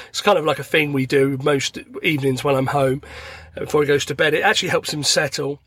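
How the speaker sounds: ordinary talk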